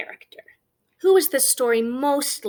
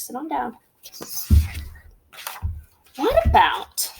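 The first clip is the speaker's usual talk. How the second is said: ordinary talk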